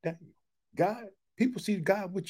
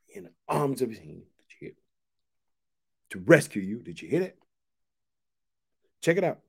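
usual speaking voice

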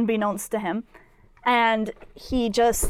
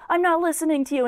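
higher-pitched, mildly indignant retort; mock rant; 6 semitones higher, much faster than usual. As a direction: imitate someone ranting back at a voice. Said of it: "little rant".

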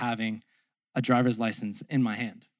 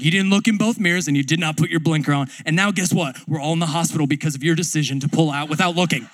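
loud and fast rant; ranting coach; 5 dB louder, faster than usual. Retold or imitated. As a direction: pile on the blame quickly. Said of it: "He's like".

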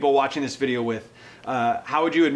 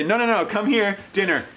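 lightly voiced herding call, higher pitched; bossy organizer; 5 semitones higher, 3 dB louder than usual. Host playfully herding guests to dinner, bossy and higher. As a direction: round everyone up, pitch lifted.

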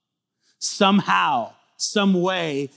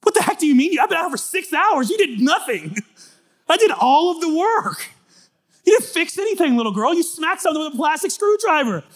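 much higher, louder hellfire shout; mocking fiery preacher; 11 semitones higher, 6 dB louder than usual. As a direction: ham up a screaming preacher. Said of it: "I just remember being like".

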